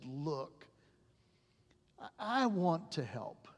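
soft, choked-up admission; quiet, broken voice; tearful doctor. Retold or imitated. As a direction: speak it gently, near tears. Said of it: "with tears in his face".

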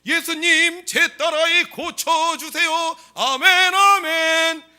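high, drawn-out full-voice shout to Jesus; loud prayer cry. Shown as a demonstration. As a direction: belt out the plea and amens, slowly. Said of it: "이러면 믿음이 있는 소리입니까". (길게 부르짖는 큰 기도 소리)

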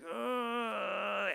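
long drawn-out high groan; strained noise of taking aim; straining grunt. Acted. Boy aiming at the duck, a long strained grunt. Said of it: "he's like".

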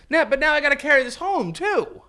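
high-pitched put-upon whine; whiny complaint. Acted. Worker stuck carrying a gift home, whiny complaint.

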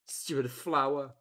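usual speaking voice

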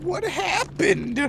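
disbelieving. Real, not acted. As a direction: ask in disbelief. Baffled by teammate's play.

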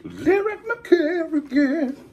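high dramatic title call, stretched out; announcer voice. Acted. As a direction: call the hero's name grandly. Closing title announcement, sung.

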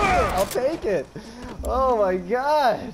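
high and slow, stretched words; drawn out. Real, not acted. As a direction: drag each word out in relief. Drawn out after the execution.

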